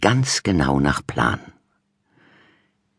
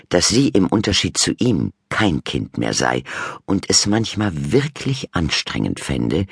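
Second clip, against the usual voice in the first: ordinary delivery, nothing marked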